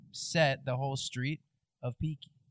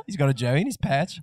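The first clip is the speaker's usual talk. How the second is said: loud mock Aussie, laughing; amused put-on Australian; Australian accent; 5 dB louder than usual. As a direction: carry on the Australian bit through laughter. Continuing the Australian bit, laughing.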